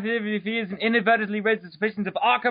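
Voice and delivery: ordinary talk, nothing marked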